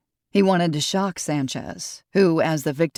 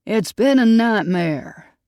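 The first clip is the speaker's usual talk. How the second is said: slow, higher and louder Texas drawl; grieving husband tells the detective, Texas drawl; thick Texas drawl; 5 semitones higher, 5 dB louder, slower than usual. A narrator's reading. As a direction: stretch it out in a heavy Texas drawl. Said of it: "he said in a thick Texas drawl".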